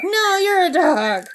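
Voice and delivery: high, loud parrot-style comeback; mock shouted squabble. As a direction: shout it back like a bickering bird. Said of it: "they'd argue".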